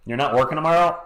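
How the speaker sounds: ordinary delivery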